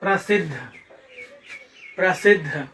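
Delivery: प्रसिद्ध with a distinct doubled द्ध; careful pronunciation. Shown as a demonstration. Teacher showing how to pronounce प्रसिद्ध, careful and clear. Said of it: "ऐसे उच्चारण करेंगे".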